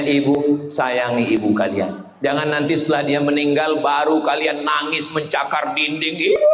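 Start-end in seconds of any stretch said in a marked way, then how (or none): 6.4-6.5 anguished cry. Acted. Son wails for dead mother.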